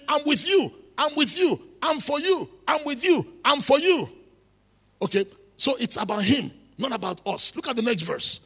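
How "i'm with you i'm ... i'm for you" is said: high, emphatic declarations of reassurance; preacher insistently voicing God's promise; insistent preaching. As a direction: drive each short promise home at raised pitch.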